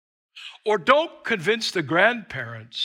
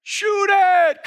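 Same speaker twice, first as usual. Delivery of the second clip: high, loud sideline shouting; frantic dad hollering at the field; yelling soccer dad; 11 semitones higher, 8 dB louder, much slower than usual. Retold or imitated. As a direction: urge the player on at full volume. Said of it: "Dad was saying".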